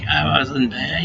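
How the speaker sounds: ordinary delivery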